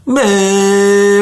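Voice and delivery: long, high drawn-out moo; high sustained cow-like call; jingle moo. Acted. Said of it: "And the jingle goes".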